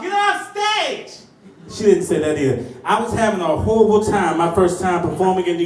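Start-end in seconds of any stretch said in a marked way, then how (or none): 0.0-1.2 higher, slow hammed heckle; mock heckler. Heckler in the crowd, slow high mock yell. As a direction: stretch it like a heckler. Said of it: "Or she didn't scream out".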